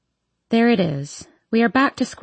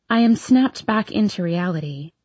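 ordinary delivery

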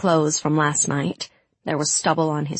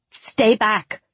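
raised, sharp warning to keep back; firm warning; 5 semitones higher than usual. A narrator's reading. Tamar holding off a crowd of pilgrims, firm warning. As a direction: warn them off, voice pitched up. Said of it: "she warned the crowd".

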